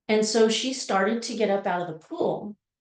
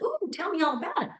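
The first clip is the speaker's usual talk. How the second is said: eager mom; 7 semitones higher, 4 dB quieter, faster than usual. Retold or imitated. Mother answers her daughter eagerly.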